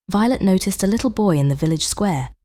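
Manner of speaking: plain reading voice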